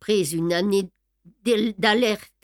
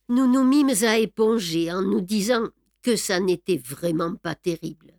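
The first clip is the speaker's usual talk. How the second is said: usual speaking voice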